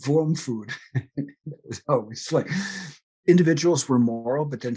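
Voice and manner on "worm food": put-on heavy German accent, w turned to v. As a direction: say it like a German grandmother. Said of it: "With her heavy German accent".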